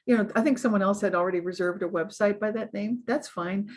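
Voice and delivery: ordinary talk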